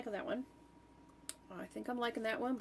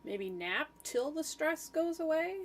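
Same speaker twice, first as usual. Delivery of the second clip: higher, slower, trailing upward; slow dreamy read-through; 7 semitones higher, 3 dB louder than usual. Shown as a demonstration. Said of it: "reads the candidate slogan aloud".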